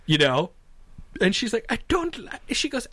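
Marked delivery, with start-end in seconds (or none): 1.7-2.5 high, small imitated voice; timid, scared lady. Passenger protesting the snake picture, timid and scared. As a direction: start a frightened little protest. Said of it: "she's being terrorized".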